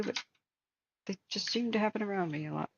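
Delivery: normal talking voice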